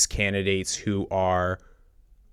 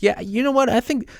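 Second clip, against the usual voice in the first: put-on Southern accent. Voicing everyday conservative voter.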